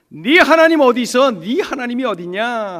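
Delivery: loud, high-pitched taunt; mocking. Foreigners taunting the believing poet about his God, mocking. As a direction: throw it out as a jeering challenge. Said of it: "하면서 조롱하는 거예요".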